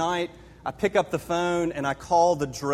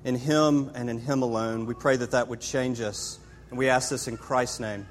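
closing prayer; 4 semitones lower than usual. Acted. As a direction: close the prayer gently, reverently. Closing prayer, quiet and reverent.

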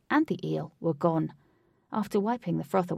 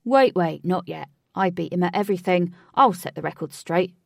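loud boast; 8 dB louder than usual. A narrator's reading. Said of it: "stocky man pushes forward".

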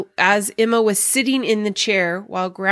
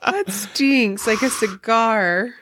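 laughing; 6 semitones higher, slower than usual. Real, not acted. Laughing at smoke.

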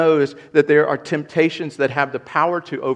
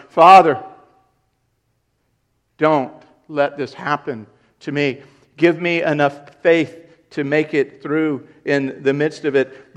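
pleading cry to God; 6 dB louder than usual. Acted. Pleading prayer to the Father.

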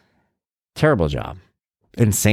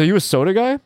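higher, teasing boss voice; gym owner quote; 9 semitones higher than usual. Retold or imitated. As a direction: lift it up and poke fun at him. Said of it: "He goes".

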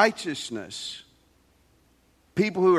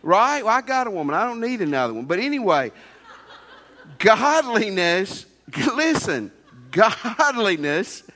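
fiery preaching; 6 semitones higher than usual. Acted. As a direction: bring it home to the congregation with fire. Preaching at full force.

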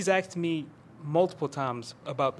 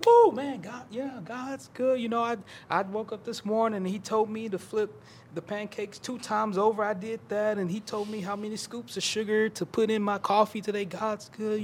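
higher-pitched, hammed-up believer fervor; mock over-spiritual passion; 5 semitones higher than usual. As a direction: imitate someone gushing over-piously about God. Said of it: "get a little emphatic and a little passionate".

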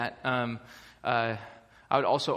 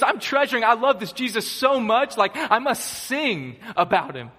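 excited Paul; 8 semitones higher, 6 dB louder than usual. Retold or imitated. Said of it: "He goes".

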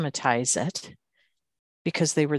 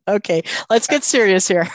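laughing; 5 semitones higher, 6 dB louder than usual. Real, not acted. Host laughing at answer.

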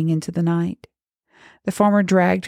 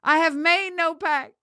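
put-on high, desperate girl's plea; begging; 12 semitones higher than usual. A narrator's reading. Girl begging the priest, high desperate voice. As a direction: protest innocence high and desperately. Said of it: "she begged".

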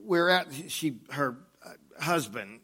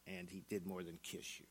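quiet grim; 3 semitones lower, 11 dB quieter than usual. Acted. Grim realisation.

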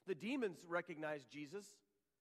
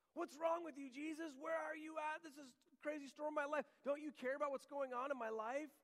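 much higher, fast, desperate complaining prayer; whiny complaint; 8 semitones higher than usual. Acted. Preacher mimics a believer's panicked prayer, high and complaining. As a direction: pitch it up like a frightened whiner.

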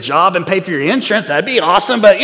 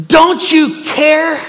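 slow, loud, high cry of don't you care; panicked disciples shouting at sleeping Jesus; desperate plea; 7 semitones higher, 4 dB louder, much slower than usual. Retold or imitated. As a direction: drag out "don't you care" in alarm, much higher and louder. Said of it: "they say".

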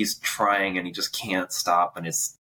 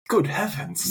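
high-pitched startled exclamation in a put-on voice; Alfred; 11 semitones higher, slower than usual. Scared Alfred seeing the mask, startled impression. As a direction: imitate a scared, shocked onlooker. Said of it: "even Alfred's like".

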